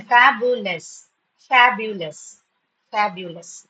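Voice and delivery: "fabulous" slowly split as fab-yu-lus; syllable by syllable. Shown as a demonstration. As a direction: repeat "fabulous" in three drawn-out beats. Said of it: "Three syllables".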